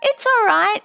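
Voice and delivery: a daughter bravely holding back tears; brave girl. A narrator's reading. Hanano reassures her mother, high bright brave girl voice. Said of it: "she said".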